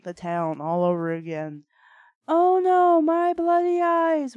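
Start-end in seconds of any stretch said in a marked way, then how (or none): 2.3-4.3 loud high British bellow. Acted. The butler bellows at the giant, loud and high. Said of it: "Watari bellowed".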